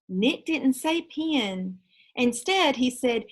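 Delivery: lively read-aloud for students; story narration. A narrator's reading. Teacher reading Frindle aloud to her class, lively narration. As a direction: read the chapter to the class with energy.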